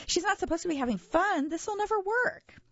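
very high, alarmed protest; frantic high-pitched complaint; blurted. A narrator's reading. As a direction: burst out in rising dismay. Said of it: "I blurted".

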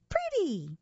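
put-on very high little-girl squeal; high, drawn-out toddler delight. A narrator's reading. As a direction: exclaim like a charmed toddler. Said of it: "Emma blinked and said".

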